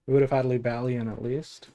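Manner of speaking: ordinary talk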